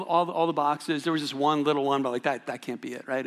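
ordinary talk, nothing marked

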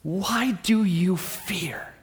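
very slow, whispered question. Retold or imitated. Jesus quietly asking his disciples, slow whisper. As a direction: breathe the question softly.

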